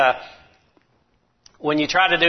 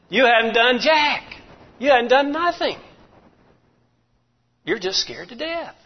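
mock scolding; 9 semitones higher than usual. Preacher mock-scolding brother.

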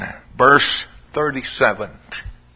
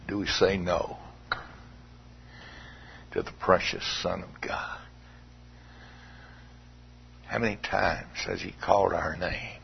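much softer, low, near whisper; hushed sorrow; 9 semitones lower, 14 dB quieter than usual. Real, not acted. Preacher appealing quietly to the congregation, hushed. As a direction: draw them in with a hush.

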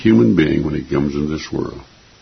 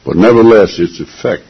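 ordinary delivery

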